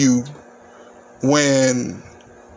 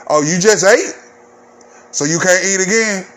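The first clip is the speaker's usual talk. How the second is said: louder, higher incredulous jab; mocking disbelief; 5 semitones higher, 3 dB louder than usual. Skinny friend needling the fat friend who refused, mocking.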